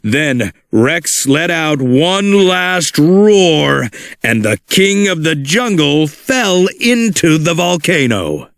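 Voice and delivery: plain reading voice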